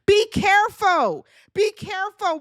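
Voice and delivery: high, slowed mock yell; imitated yell. Huda yelling at Chris over the baby, imitated high yell. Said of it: "Huda yells out to Chris".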